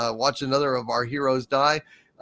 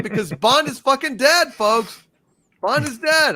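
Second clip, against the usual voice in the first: loud rant; 9 semitones higher, 5 dB louder than usual. Real, not acted. Ranting about Bond's death.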